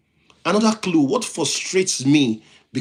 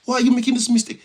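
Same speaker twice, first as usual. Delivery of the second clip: high, louder mock exasperation; exasperated outcry; 9 semitones higher, 3 dB louder than usual. Host imitates reacting to a colleague's error, mock exasperated. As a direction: throw up your hands at the mistake. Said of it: "And you're like".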